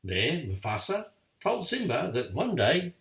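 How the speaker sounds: ordinary delivery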